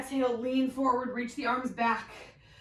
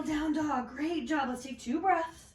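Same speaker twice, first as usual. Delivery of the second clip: normal talking voice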